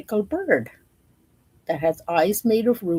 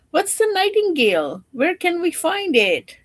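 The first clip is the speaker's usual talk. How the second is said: puzzled servants; 10 semitones higher, 3 dB louder than usual. Acted.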